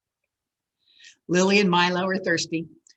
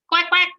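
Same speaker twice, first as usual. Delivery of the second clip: high, louder quacking; duck quack; 11 semitones higher, 5 dB louder than usual. Acted. A duck calls to Lily and Milo, high quack.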